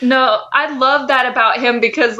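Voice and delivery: ordinary talk, nothing marked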